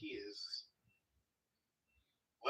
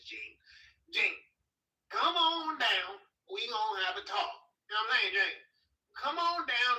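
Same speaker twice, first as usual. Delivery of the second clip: hammed-up country boy voice, higher and louder; folksy Southern good ol' boy; 7 semitones higher, 3 dB louder than usual. Folksy Southern "come on down" bit.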